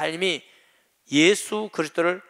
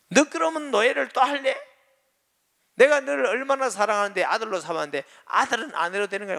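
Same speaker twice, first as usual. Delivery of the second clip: retold scolding; 7 semitones higher than usual. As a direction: roar at the stubborn boy, hurt and angry. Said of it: "되게 호통을 친 거예요".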